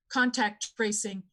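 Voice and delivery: ordinary talk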